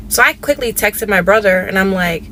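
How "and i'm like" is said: normal talking voice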